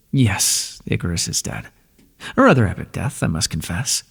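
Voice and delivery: ordinary talk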